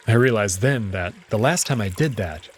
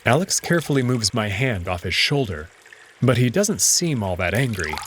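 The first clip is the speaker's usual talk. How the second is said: ordinary delivery, nothing marked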